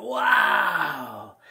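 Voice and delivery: loud, high drawn-out awestruck yell; amazed hollering. Retold or imitated. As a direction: holler in wonder like a crowd of kids. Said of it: "We're all hollering".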